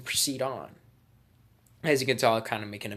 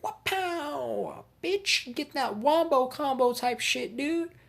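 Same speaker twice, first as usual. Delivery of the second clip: very high, loud falsetto taunt; cartoon attack cry; 7 dB louder, much slower than usual. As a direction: squeal it out like a combo move. Acting out a surprise attack.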